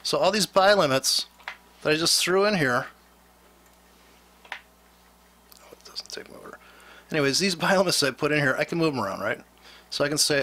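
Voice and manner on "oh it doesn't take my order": real whisper under the breath; whispered frustration at the screen; whispered aside. Real, not acted. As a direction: mutter it to yourself, unvoiced. Aside while the platform rejects the order.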